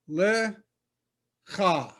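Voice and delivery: "Le cha" in two separated syllables; teacher sounding out "Le ... cha"; syllable-by-syllable demo. Shown as a demonstration. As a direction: sound out "Le", pause, then a throaty "cha". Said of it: "That's the L sound".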